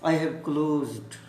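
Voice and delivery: slowly, word by word; slow repeat. Shown as a demonstration. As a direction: lay each word out for the students. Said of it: "दरवाजे की बात कर रहा था". (धीमे से दोहराना)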